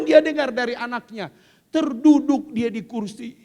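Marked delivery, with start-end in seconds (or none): none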